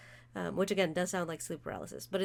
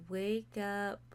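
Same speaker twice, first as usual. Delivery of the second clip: normal talking voice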